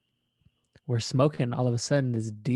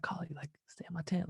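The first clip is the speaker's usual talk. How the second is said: soft whisper, a touch higher; whispered offer; 6 semitones higher, 10 dB quieter than usual. Retold or imitated. Inviting his friend Kali into the big tent, in a whisper.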